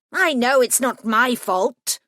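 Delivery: grieving gremlin snapping at the detective, harshly. A narrator's reading. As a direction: snap back, lightly voiced. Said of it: "I didn't mean to sound so harsh".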